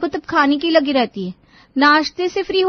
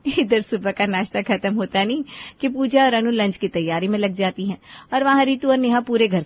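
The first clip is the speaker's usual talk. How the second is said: story narration; 7 semitones lower than usual. A narrator's reading. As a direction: lower narrator voice. Narrator voice between scenes.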